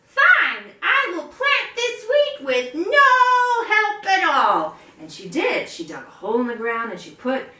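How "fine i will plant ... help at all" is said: much higher, louder, slow and firm; determined hen. Acted. Hen refuses to quit, high determined voice. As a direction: declare it with stubborn resolve. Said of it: "She said".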